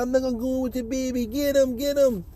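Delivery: high mock woman's voice. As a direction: egg them on in her voice. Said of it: "She said".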